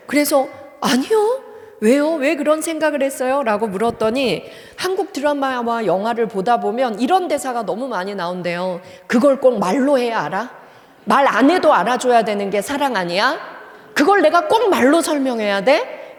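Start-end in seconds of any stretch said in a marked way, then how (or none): 0.8-3.4 a pitched-up, louder "no, why?"; reply in disbelief to an odd question; incredulous counter-question; surprised denial. Retold or imitated. Professor retorts to student's question. 9.1-16.2 louder, hurt reproach to a partner; reproachful quarrel. Retold or imitated. Acting a couple's quarrel line.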